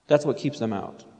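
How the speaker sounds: normal talking voice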